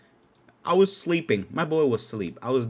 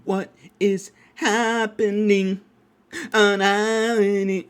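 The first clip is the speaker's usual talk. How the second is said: slow, higher, stretched out; drawn-out high disbelief; 6 semitones higher, 4 dB louder, much slower than usual. Shown as a demonstration. Repeating the question slowly in disbelief. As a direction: drag each word out in disbelief. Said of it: "what's happening?".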